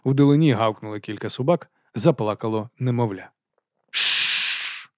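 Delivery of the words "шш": long, sharp hissing shush; hissed shush. A narrator's reading. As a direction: hiss to silence a noisy partner. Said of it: "прошипів Нобі".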